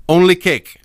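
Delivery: put-on curt, loud German accent on Only cake; German baker answering a tourist, thick German accent. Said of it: "thick accent".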